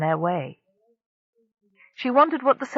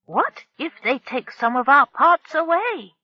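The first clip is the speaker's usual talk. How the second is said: lightly voiced anxious shipbuilder; 8 semitones higher, 4 dB louder than usual. A narrator's reading. Narrowleg anxious.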